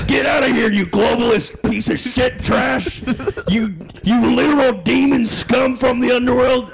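rip into him furiously; angry shouting. Pretend. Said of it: "you see how angry he is".

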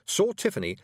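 ordinary delivery, nothing marked

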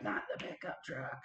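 high unvoiced laughing panic; panicked whisper. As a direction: gasp it out in mock horror. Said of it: "And I'm like".

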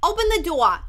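put-on loud New Jersey mom calling to her kid; New Jersey mom accent. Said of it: "I heard this mom".

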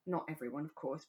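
ordinary talk

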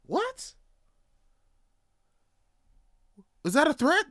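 high-pitched affronted comeback; indignant disbelief. Acted. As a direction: react as if challenged, pitch jumping up. Acted indignant reply to hikers.

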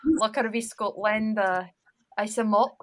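sample lines in a Scottish accent. Shown as a demonstration. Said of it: "Can you give us a line?".

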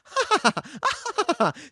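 high, mocking laugh; imitated cackle. Imitating Mombi laughing at them, high cackle. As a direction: cackle like a gloating villain. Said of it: "she's just laughing at them".

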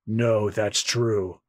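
low, slow grunt of agreement; a deep, unhurried pirate boss grunting yes; gruff pirate captain. Acted. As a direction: answer like a heavy brute captain. Voicing Don Krieg replying.

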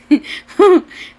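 loud laugh breaking out; can't hold back the laugh. Real, not acted. Retelling the dad's teasing, she laughs out loud.

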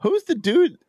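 very high, startled mock voice. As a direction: squeak it out like a baffled giant. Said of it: "There's Mahoney's like".

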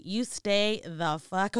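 usual speaking voice

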